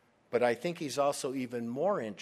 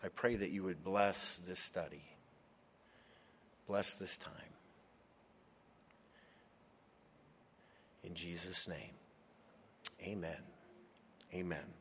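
prayer; 4 semitones lower, 9 dB quieter than usual. Real, not acted. Pastor opening the study, soft prayerful voice. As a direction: pray quietly over the study.

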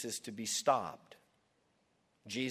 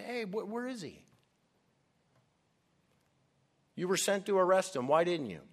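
higher, hurried questioning of returning guards; mock indignant boss; acted indignant demand; 6 semitones higher, faster than usual. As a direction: demand an explanation, pitch jumping up. Priests demanding answers from guards in retelling.

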